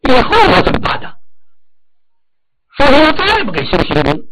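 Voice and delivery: high, loud indignant complaint; acted outburst of an aggrieved son; angry son. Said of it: "他火了".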